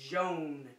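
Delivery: jaune with a soft French zh, nasal o. Shown as a demonstration. Teacher prompts kids to repeat: jaune, French zh sound. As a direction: model jaune slowly for repeating. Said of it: "Try it. Say".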